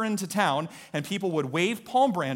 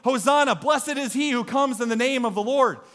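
raised, carrying acclamation; imitated crowd yell; 5 semitones higher than usual. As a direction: cry it out like a welcoming crowd. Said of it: "everybody starts yelling".

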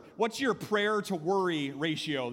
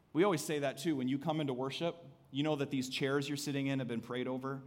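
ordinary talk

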